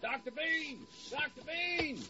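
distant soft shouts; calling from afar. Acted. Man outside calling the doctor, heard from far off. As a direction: call his name from down the street.

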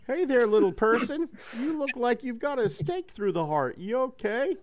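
high, friendly robot voice; chirpy robot checking on you. Acted.

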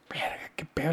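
hushed scared whisper. Retold or imitated. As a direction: whisper it like you're sneaking in. Scared inner thought retold. (susurro asustado)

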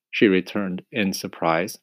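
ordinary delivery

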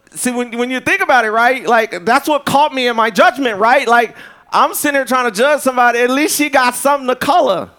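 high, loud, rising preacher fervour; preaching at full force. Acted. Preacher building to a peak.

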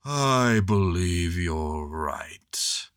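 slow, sighing donkey voice; mournful Eeyore sigh; long sad sigh. A narrator's reading. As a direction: sigh the words out. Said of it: "with a long, sad sigh".

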